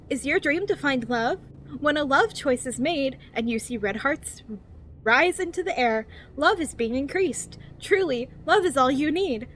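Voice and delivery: over-enunciated guidebook narration, lilting up and down; cheery infomercial voice. Acted. Reading a survival guide to new players, cheery infomercial voice. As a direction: read it like a relentlessly positive infomercial. Reads the game's guide book.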